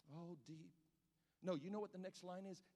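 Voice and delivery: usual speaking voice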